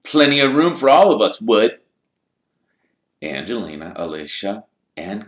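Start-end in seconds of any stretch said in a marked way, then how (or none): 0.0-1.8 sly brute. A narrator's reading. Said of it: "trollish cunning on his face".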